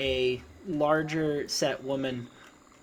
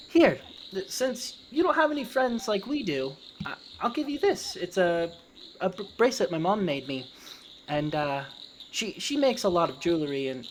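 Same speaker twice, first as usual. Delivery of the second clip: put-on higher, halting kid voice; child character; 5 semitones higher than usual. Boy giving the goblin his bracelet, childlike and halting. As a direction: offer it in a high, childlike voice. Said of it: "and he says".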